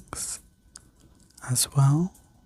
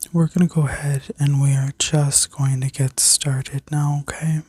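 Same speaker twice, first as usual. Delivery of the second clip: sleep story narrator. Acted. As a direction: guide them gently into rest. Leads a guided healing meditation.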